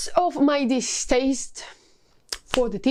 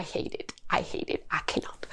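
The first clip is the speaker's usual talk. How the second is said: whispered disgust; 7 semitones lower, 10 dB quieter than usual. Real, not acted.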